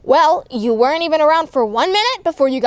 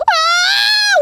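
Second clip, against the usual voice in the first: high wailing scream as it falls; hammed-up ride scream; frightened scream; 5 dB louder than usual. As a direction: shriek in mock terror. Stuck rocket falls, screaming.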